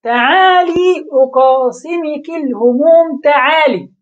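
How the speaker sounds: slow, lifted verse reading with each تعالي drawn out; poem recital. Retold or imitated. Teacher quoting a poet's faulty verse to students, recited slowly like poetry. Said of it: "شاعر قال".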